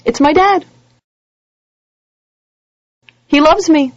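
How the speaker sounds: high, loud, thrilled relief; relieved delight. Acted. Defendant spotting his dad on the bench, thrilled relief. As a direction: burst out with relief.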